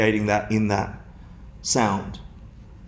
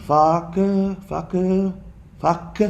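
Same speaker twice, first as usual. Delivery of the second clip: repeated word demo; 7 semitones higher, much slower than usual. Shown as a demonstration. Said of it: "The sound doesn't have the offensive nature".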